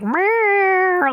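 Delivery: long, high imitated meow; drawn-out complaining meow; cat yowl. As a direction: wail like a trapped cat. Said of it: "hear Smokey being like".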